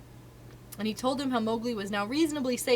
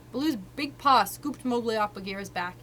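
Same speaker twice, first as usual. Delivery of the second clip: ordinary delivery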